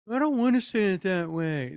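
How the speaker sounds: high, loud, whiny refusal; mimicked whining. Child balking at practicing the right way, mock whining. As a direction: whine back like a stubborn kid. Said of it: "that thing called whining".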